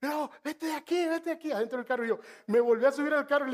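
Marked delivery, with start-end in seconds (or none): 0.0-1.5 higher, scared cry to go away; a startled young woman shooing him off; frightened plea. Retold or imitated. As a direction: push the stranger off in a fright. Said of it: "la muchacha se espanta y dice".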